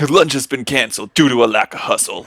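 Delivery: loud drill-sergeant camp boss; Ben Stiller impression. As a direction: bark it like a fitness camp tyrant. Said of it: "Good Ben Stiller".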